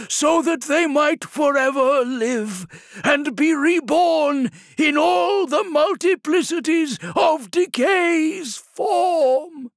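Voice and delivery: high, loud, slow warbling invocation; warbling voice rising. A narrator's reading. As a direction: raise the warbling prayer to a cry. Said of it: "His warbling voice rose".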